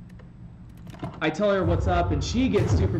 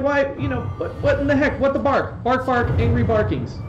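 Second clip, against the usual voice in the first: mock angry barking; 7 semitones higher, 4 dB louder than usual. His mock angry reaction, retold.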